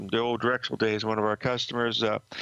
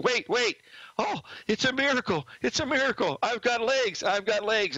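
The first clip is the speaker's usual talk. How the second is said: much higher, hammed-up ecstatic cry of a healed cripple; fake miracle cure; 11 semitones higher than usual. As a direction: play the miraculously healed man, over the top. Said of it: "I was just doing it off the cuff".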